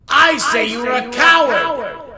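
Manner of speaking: loud jumbled echo fading. Acted. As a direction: overlap the words like an echo. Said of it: "echoed the lion in the well".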